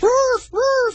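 high excited barks; dog bark. Acted. Dog home at last barking, high and eager. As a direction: yap again and again at the door. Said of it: "he barked".

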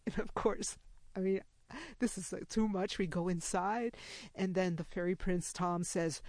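ordinary talk, nothing marked

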